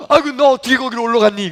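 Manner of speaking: high, loud, rushed exclamation; acted shock, tumbling out fast; alarmed father. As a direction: cry out in startled alarm. Father finds child up the tree.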